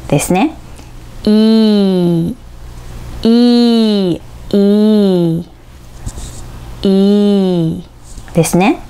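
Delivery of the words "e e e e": "E" stretched as a long, even ee; slow, isolated "E", drawn out; long E vowel. Shown as a demonstration. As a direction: hold each "E" for learners to copy. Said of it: "長く伸ばす母音".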